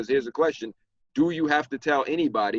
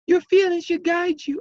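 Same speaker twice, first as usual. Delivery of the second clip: much higher, unhinged villain squeal; crazy voice; 5 dB louder than usual. Acted. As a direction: read it like a deranged villain. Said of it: "I gotta read this in the crazy voice".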